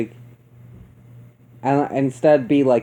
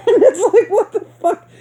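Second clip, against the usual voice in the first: high, laughing out the words; cracking up mid-sentence; can barely get it out for laughing; 8 semitones higher, 4 dB louder than usual. Real, not acted. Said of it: "I was dying the entire time".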